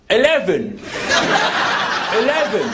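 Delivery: put-on English accent, falling tone; attempted English accent. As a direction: have a go at sounding English. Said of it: "Try an English accent".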